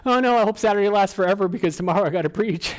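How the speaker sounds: high, hammed-up fretting; mock dread. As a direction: play the nervous preacher for laughs. Acting out dread before preaching.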